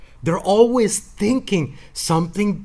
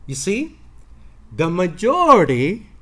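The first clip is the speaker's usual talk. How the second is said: ordinary talk, nothing marked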